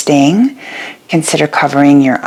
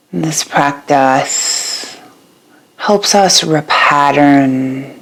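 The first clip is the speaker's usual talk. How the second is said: guided meditation. Acted. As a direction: guide them gently into rest, letting each phrase settle. Leads a yoga nidra meditation.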